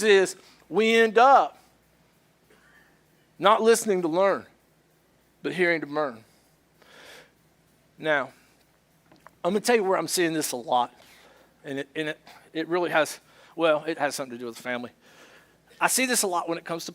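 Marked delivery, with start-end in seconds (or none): none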